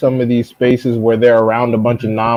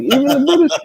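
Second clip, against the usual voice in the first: squeaky high mock-incredulous cry; a hammed-up falsetto gasp of disbelief; 11 semitones higher than usual. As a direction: pitch it way up in fake shock. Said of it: "I was like".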